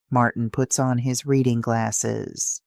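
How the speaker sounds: ordinary delivery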